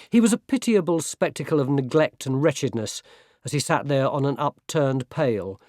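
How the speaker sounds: ordinary delivery, nothing marked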